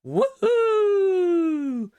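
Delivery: long high loud whoop; excited whoop. Real, not acted. Celebrating adverts again.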